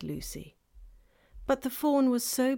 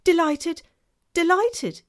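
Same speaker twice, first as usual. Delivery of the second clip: high and loud, delighted; 10 semitones higher, 10 dB louder, slower than usual. Acted. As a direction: gush with delight. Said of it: "it went on".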